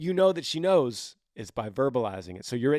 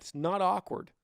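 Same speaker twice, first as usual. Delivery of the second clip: ordinary delivery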